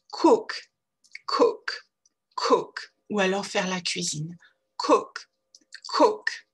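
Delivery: Cook with a short relaxed oo; English short oo. Shown as a demonstration. Teacher drilling Cook for French learners with a short oo. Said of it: "pour réussir à prononcer cette voyelle".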